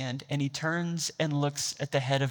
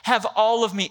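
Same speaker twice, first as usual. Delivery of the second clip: impassioned louder repeat; louder, higher; 5 semitones higher, 5 dB louder than usual. Retold or imitated. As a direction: Have all of me said louder and higher. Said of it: "He said".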